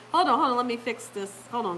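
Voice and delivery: ordinary talk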